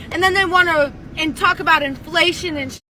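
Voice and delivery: usual speaking voice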